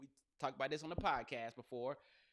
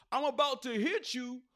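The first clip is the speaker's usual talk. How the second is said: high, loud fake tough talk; mock threat; 7 semitones higher, 6 dB louder than usual. Comic mocking players who fake fights, mock threat. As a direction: play up a put-on threat. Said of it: "Now it's all this".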